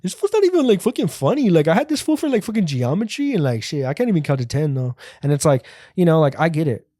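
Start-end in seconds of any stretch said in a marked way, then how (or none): 0.0-4.9 high, sneering doubter voice; mocking a doubter. Scornful doubter mocking the wannabe comedian. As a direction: jeer like a classmate who thinks you're a fool. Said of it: "people are looking at you like".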